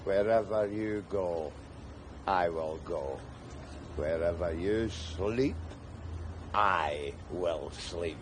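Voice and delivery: low, soft and slow vow; solemn mock vow. Rescued man to the tramp, low, soft and slow. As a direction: intone it like a wedding promise.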